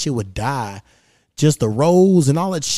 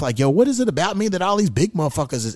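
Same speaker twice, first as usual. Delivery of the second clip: ordinary talk, nothing marked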